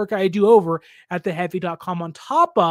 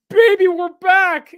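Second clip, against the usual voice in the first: much higher, louder, slow and drawn out; triumphant cheer; 10 semitones higher, 8 dB louder, slower than usual. Retold or imitated. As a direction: crow it like the team is back. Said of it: "or be like".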